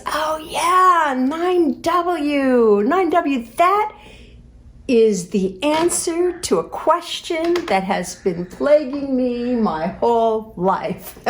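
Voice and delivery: high, gleeful mock epiphany; imitating husband's eureka; mock revelation. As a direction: ham up a grand discovery. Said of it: "he says".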